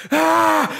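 very high mock battle cry. Handler charging the monster with a bowgun, mock battle cry. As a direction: imitate a high-pitched charging yell. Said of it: "run into the fight and go".

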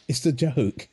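ordinary talk

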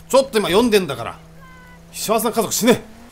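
loud, high mock-villain outburst; irritated yell. Player yelling at game characters interrupting his reading, irritated shout.

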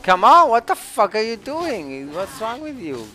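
angry shout. Real, not acted. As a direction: blow up at the teammate. Angry outburst at teammate.

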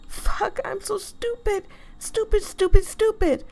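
high frustrated self-scolding. Real, not acted. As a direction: beat yourself up, pitched high. Scolding herself, lost.

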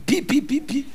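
high imitated meter beeps; quick, high pings; beeping machine. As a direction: beep like a nagging electric meter. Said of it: "E C G and still beeping".

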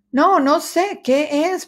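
high squeaky question; curious mouse. A narrator's reading. Mouse asking the dog what he found, high. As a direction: pitch it way up, eager. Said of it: "preguntó Ratón Ramón".

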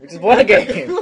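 shrieking with joy over the win; shrieking jackpot winner. Real, not acted. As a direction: squeal it out, laughing and thrilled. Celebrating winning a game, laughing.

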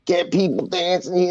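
high-pitched tipsy phone rant; drunken caller. Retold or imitated. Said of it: "it's a drunken phone call".

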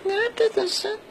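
high falsetto mother voice; shrill comic mom voice, way up high; turkish cartoon dub. Man voicing the mother in falsetto.

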